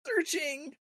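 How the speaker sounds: high mock yell, held back in volume; Dean yelling. Host echoes co-host's game hero impression, mock yelling. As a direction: echo the hero's cry in a high voice. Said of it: "he just keeps constantly yelling".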